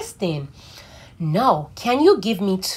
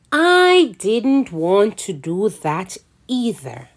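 plain reading voice

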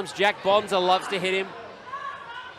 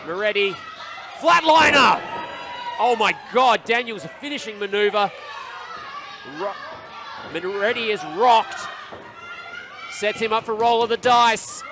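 wrestling commentator; 2 semitones higher than usual. Real, not acted. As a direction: call the finish at full volume. Excited live play-by-play.

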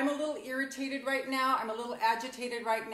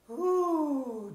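a louder, higher ooh as the breath goes out; breathy ooh of relief; 5 semitones higher, 5 dB louder than usual. Real, not acted. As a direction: let the breath out with a pleased ooh. After taking a deep breath.

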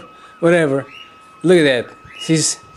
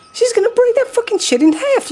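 much higher, thrilled rising cry; excited high squeal. Real, not acted. Cheering the mother bear on at the fence, excited squeal. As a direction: shriek it with glee as she attacks.

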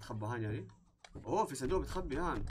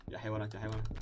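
ordinary talk

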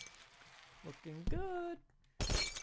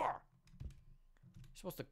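unvoiced whispered aside; 11 dB quieter, much slower than usual. Real, not acted. Gamer to viewers, whispered aside. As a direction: whisper it like a secret.

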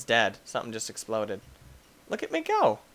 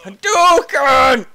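loud slow drawn-out battle cry; fighting game shout; 8 semitones higher, 8 dB louder, much slower than usual. Acted. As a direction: yell it like a special move. Video game move shout.

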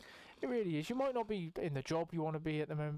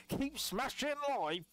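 hyped up; 8 semitones higher, slower than usual. Real, not acted. Hyped sign-off to viewers.

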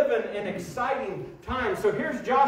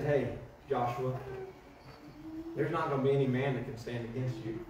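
soft voice of God; 9 semitones lower, 9 dB quieter than usual. Acted. God's soft pep talk.

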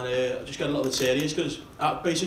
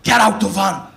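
loud barked order, much louder; kidnapper shouting; 11 dB louder, slower than usual. Retold or imitated. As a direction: bark it at the captive. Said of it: "he goes".

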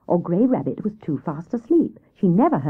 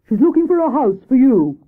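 Hare voice; 6 semitones higher, 6 dB louder than usual. A narrator's reading. Hare answers Owl.

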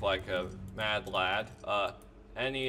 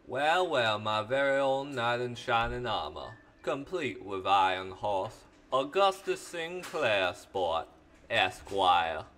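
Southern character impression, lilting and stretched on the name; Augustus Sinclair, Southern accent. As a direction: announce yourself grandly in a Southern accent. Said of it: "do a impression of the character with a similar, similar accent".

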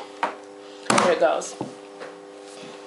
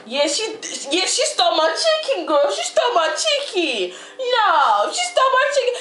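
gossipy, aggrieved phone-call voice; sassy, indignant girl; 9 semitones higher, 4 dB louder than usual. Acted. Calling a friend about her stolen chicken, indignant. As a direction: work up the sassy outrage to a friend.

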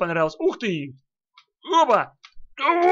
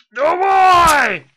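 shout; 10 semitones higher, 9 dB louder than usual. Real, not acted. Attacking in game.

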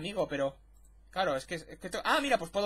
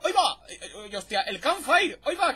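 higher, louder "ay va" of surprise; startled exclamation; 3 semitones higher, 5 dB louder than usual. Retold or imitated. Streamer reliving fumbling tools, startled yelps. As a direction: blurt it out caught off guard.

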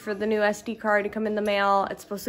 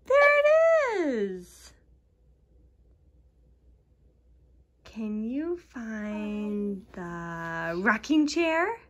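put-on reading to a toddler; much slower than usual. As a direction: draw the little one into the game. Seek-and-find game with toddler.

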